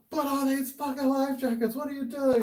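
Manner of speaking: high, loud, exasperated order; imitated scolding. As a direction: mimic a rescuer chewing out dumb kids. Imitates angry rescuers scolding teenagers.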